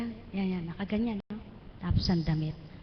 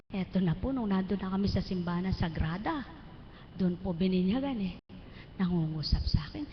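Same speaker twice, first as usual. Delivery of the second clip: ordinary talk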